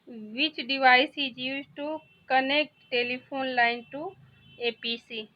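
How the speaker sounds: each word read out very slowly; slow dictation. Shown as a demonstration. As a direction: read it slowly for students to follow. Teacher reads English quiz question. (धीरे-धीरे पढ़कर बोलना)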